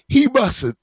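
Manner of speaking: words stretched by a yawn. Real, not acted. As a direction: talk through a yawn. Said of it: "oh, sorry about the yawning".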